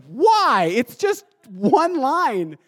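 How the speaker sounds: high mock-exasperated protest. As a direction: protest loudly on the first word, then drag it out. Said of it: "I was like".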